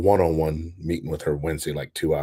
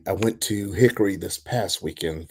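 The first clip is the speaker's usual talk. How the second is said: ordinary talk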